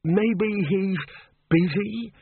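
timid, high and hesitant; quavering. A narrator's reading. Bursar timidly suggesting an excuse, high quaver. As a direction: quaver it tentatively, rising in pitch. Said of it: "Eventually the bursar quavered".

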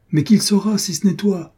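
agitated exclamation. A narrator's reading. Chazelle gripping his friend's arm, crying out insistently. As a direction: press the challenge, pitched well up. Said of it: "s'écria Chazelle en prenant le bras".

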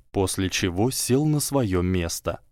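plain reading voice

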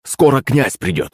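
ordinary delivery, nothing marked

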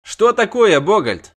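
loud high question; high startled cry. A narrator's reading. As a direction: press Bogold for news. Dandelion repeats question.

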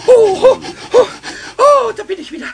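exhausted groans. Acted. Arriving home exhausted.

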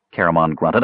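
plain reading voice

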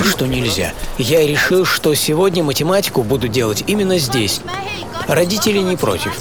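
ordinary delivery, nothing marked

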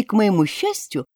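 plain reading voice

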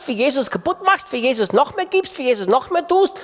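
ordinary talk, nothing marked

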